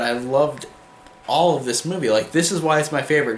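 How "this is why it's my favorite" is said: ordinary delivery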